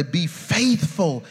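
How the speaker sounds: ordinary talk, nothing marked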